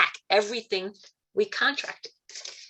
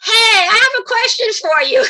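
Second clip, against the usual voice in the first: much higher and louder, calling out to Gabriel; excited high call; 10 dB louder than usual. Retold or imitated. Calling out to Gabriel with his question, high and loud. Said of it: "And I went".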